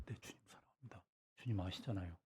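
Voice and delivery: breathless whisper; faint crushed whisper of love; whispered reply. Pretend. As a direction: barely get the words out. Peter's broken third answer.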